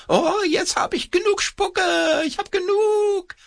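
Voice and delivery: extremely high shrieking puppet. A narrator's reading. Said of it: "seine linke Hand schreit".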